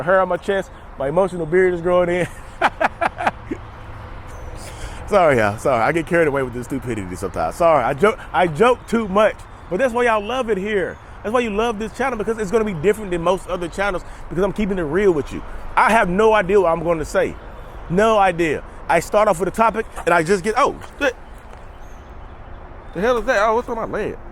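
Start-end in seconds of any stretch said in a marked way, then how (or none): 2.3-5.0 high, wheezy cackle; real laughing fit. Real, not acted. Vlogger cracking up over his own silly joke, real laughter. 20.6-24.2 startled, high-pitched cursing; real startled. Real, not acted. Vlogger finds something on his leg mid-filming, genuinely startled.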